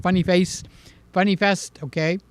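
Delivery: ordinary talk, nothing marked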